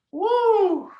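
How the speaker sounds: loud high whoop. Real, not acted. Cheering at end of round.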